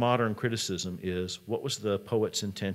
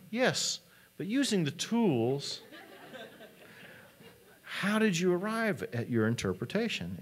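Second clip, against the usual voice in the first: higher, slower, pressing teacher voice; insistent teacher; 10 semitones higher than usual. Retold or imitated. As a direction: lean in and press him, pitched up. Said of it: "trying to get him to make his case from evidence".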